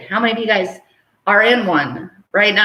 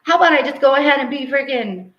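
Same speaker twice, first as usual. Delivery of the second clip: breezy angel; 5 semitones higher, 3 dB louder than usual. Retold or imitated. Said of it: "And they're like".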